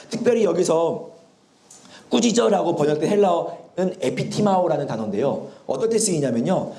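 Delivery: ordinary delivery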